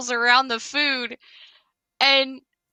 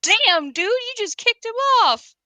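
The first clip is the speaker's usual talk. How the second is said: excited; 11 semitones higher than usual. Real, not acted. Watching squirrel fight.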